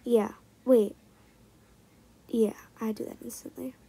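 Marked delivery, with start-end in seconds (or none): none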